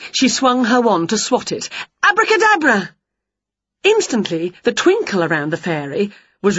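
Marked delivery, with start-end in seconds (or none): 2.0-2.9 high, loud spell call; spell cast. A narrator's reading. Winnie swatting the fairy, loud high spell. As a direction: fling the magic word.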